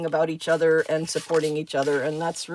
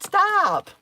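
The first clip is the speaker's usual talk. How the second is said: playful pet scolding; 12 semitones higher, 4 dB louder than usual. Real, not acted.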